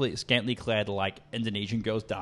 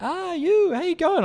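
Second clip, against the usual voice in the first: very high, louder imitated greeting; chatty woman's greeting; 5 dB louder, slower than usual. Random chick comes up to him, high-pitched friendly imitation. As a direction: pipe up like an overfriendly stranger. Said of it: "chick comes up to me".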